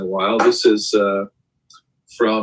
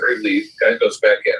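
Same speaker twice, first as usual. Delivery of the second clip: ordinary talk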